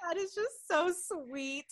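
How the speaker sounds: high, slow, gushing; touched and tender. Retold or imitated. Telling her cohost how the medium's words moved her, slow and gushing. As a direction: melt a little on it.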